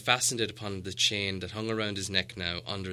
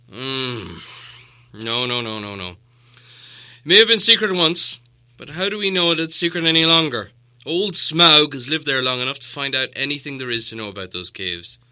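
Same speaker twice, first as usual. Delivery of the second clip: doubting dwarf; 4 semitones higher, 10 dB louder than usual. Acted. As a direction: wave the idea away, doubtful. Thorin doubts the secret door.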